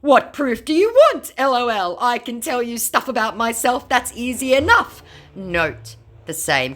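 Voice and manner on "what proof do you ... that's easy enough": high, loud mock scammer; mock scammer again. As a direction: squeak the pasted reply. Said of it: "elicited this response".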